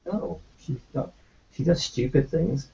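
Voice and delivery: ordinary talk, nothing marked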